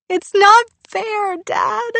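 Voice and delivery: high, loud, slow wail; crying into chest. Acted. Girl cries into her father's chest. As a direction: cry it into his chest. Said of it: "she cried into his chest".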